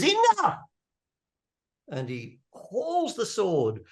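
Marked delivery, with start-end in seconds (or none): 0.0-0.6 high, loud burst of resolve; resolute cry. Acted. Young king finds his resolve before the bully, high resolute shout. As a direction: cry out suddenly, far higher and louder. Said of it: "he gets this resolve, and he says".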